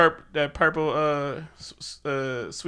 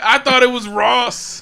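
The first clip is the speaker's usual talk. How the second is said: slow, loud, high exclamation; comic drawn-out disbelief; mock amazement; 8 semitones higher, 7 dB louder, slower than usual. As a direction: stretch it out, on the edge of laughter. Joke reaction shouted at the reveal.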